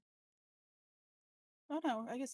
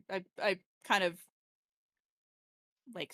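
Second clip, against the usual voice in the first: usual speaking voice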